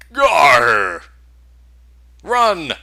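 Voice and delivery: sudden loud cry of alarm; shout. Acted. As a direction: yell it out in panic. Ogres ambush, shouting.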